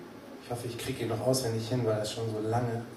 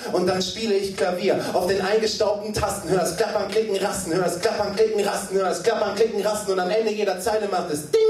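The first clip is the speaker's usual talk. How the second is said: repetitive typewriter rhythm, ending on a high ding; slam poetry recitation; 7 semitones higher, 4 dB louder than usual. Acted. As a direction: chatter like clacking keys. Acts out typewriter in poem.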